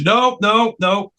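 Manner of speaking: high, insistent string of refusals; mock bossy overruling; acted refusal. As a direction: cut him off firmly. Acts Brian cutting Rick off.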